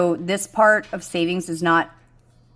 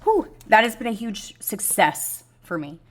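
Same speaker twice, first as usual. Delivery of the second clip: fighting tears; 4 semitones higher than usual. Real, not acted. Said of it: "Sorry, I gotta calm down".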